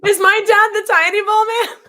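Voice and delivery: high, loud mock astonishment; mock-shocked question. Guest reacting to the host's picture clue, mock-shocked. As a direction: blurt it out high, as if scandalized. Jokes about a big reveal.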